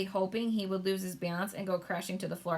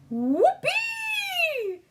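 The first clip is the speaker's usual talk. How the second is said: much higher, louder whoop; shouted; 7 dB louder than usual. A narrator's reading. Giant celebrating his whiz popper, shouting. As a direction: whoop with joy. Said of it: "shouted the B F G".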